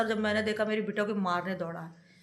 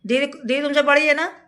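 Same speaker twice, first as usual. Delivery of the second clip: louder, higher insistent question; stern scolding; 4 semitones higher, 7 dB louder than usual. Real, not acted. Scolding child, demanding agreement. (डाँटकर पूछते हुए)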